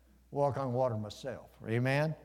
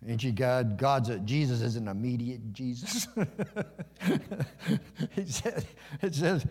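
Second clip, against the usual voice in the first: ordinary delivery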